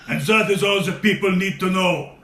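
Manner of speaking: a hint of a German villain's accent; wrestler's parting line in a faint German accent; mock German accent. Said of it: "always speak in this heavy German accent".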